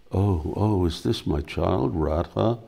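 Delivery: ordinary delivery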